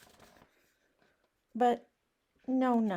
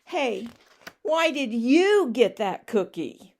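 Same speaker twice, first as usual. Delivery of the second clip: louder, demanding pigeon; upset pigeon; 3 semitones higher, 9 dB louder than usual. Acted. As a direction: confront the duckling, louder. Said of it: "He's really upset".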